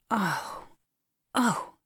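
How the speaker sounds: mild groans of dismay. A narrator's reading. Miss Matilda groans as Molly begins to cry, groaning. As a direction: groan it out, one then another. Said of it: "groaned Miss Matilda".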